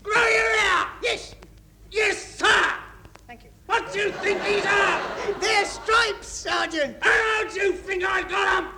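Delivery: drill sergeant yell. Acted. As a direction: bellow at the squad. Drill sergeant bawling at squad.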